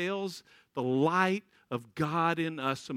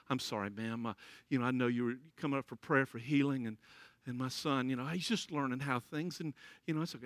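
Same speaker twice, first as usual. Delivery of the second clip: lower, softer embarrassed apology; apologetic father; 8 semitones lower, 8 dB quieter than usual. Retold or imitated. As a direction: apologize sheepishly, hushed.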